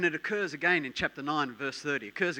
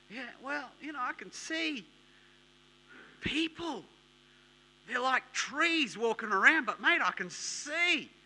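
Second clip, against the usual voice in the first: blind man; 8 semitones higher, 4 dB louder than usual. Acted. Blind man answers Jesus, half healed.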